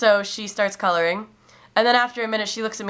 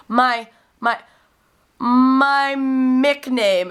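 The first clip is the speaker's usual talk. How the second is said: my Mick name very slow, higher and louder; insistent repeat; 7 semitones higher, 5 dB louder, much slower than usual. Retold or imitated. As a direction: stretch out my Mick name, each word insisting. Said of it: "And so he was like".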